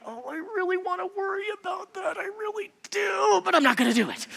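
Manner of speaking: high strained longing for worry, then settling; a playful inner voice that wants to worry; mock craving. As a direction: whine it as the worried self, then resolve. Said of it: "where this is like".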